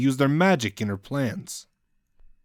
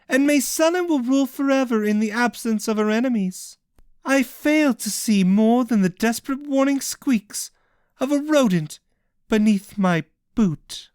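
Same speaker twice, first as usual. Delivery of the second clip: higher, triumphant gloating; gloating villainess; 10 semitones higher than usual. Acted. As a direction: boast over the fallen. Cassian gloats with arms spread.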